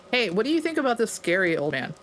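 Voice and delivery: normal talking voice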